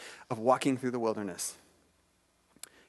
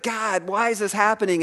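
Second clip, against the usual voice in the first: raised, louder plea to God; crying out to God; 6 semitones higher, 6 dB louder than usual. Retold or imitated. Habakkuk asks God about the evil around him, crying out. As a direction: call it upward, pitched higher, as a retold plea. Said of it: "he's crying out to God".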